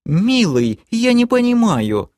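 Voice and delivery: high, hurt whine; pouting girlfriend. A narrator's reading. Girlfriend who doesn't understand her knight, high pouting whine. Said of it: "обиженно надула губы красотка".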